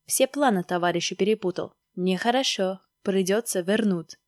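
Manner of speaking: put-on Stalin figure with Georgian accent. Stalin teases and orders Maskin, Georgian accent. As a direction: talk like a Georgian-accented leader on the phone. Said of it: "с грузинским акцентом засмеялась трубка".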